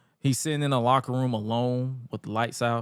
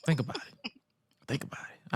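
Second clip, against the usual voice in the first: hushed aside; 9 dB quieter than usual. Real, not acted. Repeats catchphrase, dropping to whisper.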